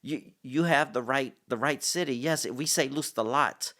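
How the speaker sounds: high, loud burst of recognition; excited realization. Retold or imitated. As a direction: retell it as a sudden excited breakthrough. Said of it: "I'm excited".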